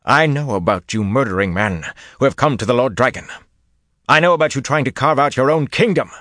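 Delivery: rising from intense to a fevered high shout; growing hotter. Acted. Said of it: "The prophet's voice grew hotter by the word".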